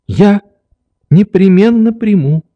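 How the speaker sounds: put-on high, lively child's voice; eager little girl. A narrator's reading. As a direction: promise brightly in a high voice. Nelly promises the doctor, teasing.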